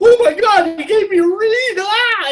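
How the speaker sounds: very high, loud fanboy squeal; freaking out. Retold or imitated. Young player just handed his idol's reed, freaking out. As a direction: shriek it in hammed-up starstruck ecstasy. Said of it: "I'm just, I'm freaking out".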